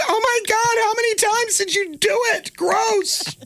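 put-on high, shrill-free squeal of a disgusted teenager; grossed-out teen daughter. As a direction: play the horrified teen walking in. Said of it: "She goes".

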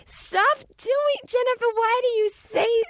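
high-pitched, drawn-out scolding; mad mom impression. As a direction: imitate an exasperated mom defending her idol. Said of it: "She gets so mad at me".

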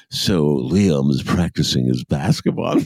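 mock British documentary narrator, slow; imitated British accent. Host teasing a documentary's British narrator, imitated British accent. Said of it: "The, the narrator has a British accent".

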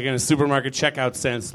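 ordinary delivery

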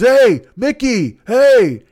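retold yell. Passenger yelling to his friend in a fight, retold yell. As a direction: call out slowly to a friend at a distance. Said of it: "he yells at his friend".

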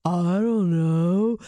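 slow, dragged-out stoned reply; imitating a dazed man, stretching every word; stoned dad. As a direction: draw it out, utterly out of it. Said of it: "he goes".

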